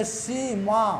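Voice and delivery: सीमा slowly in two long syllables; खींचकर उच्चारण. Shown as a demonstration. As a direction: hold both सी and मा in सीमा. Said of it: "इसको उच्चारण करने के बाद आ आए". (सीमा के दोनों अक्षर खींचकर)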